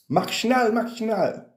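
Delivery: high barked German command; mock German order. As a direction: bark it like a cartoon German officer.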